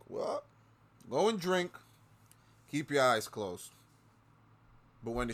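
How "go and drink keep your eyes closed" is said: normal talking voice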